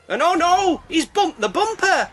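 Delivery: high, loud, alarmed commentary; panicked shout at the tailgater; excited yelling. Real, not acted. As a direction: yell at the driver who rammed them. Car bumped, yelling at baddie.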